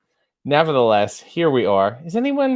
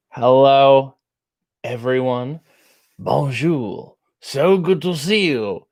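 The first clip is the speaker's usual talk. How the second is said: fake French accent; slower than usual. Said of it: "my new character is not a French guy".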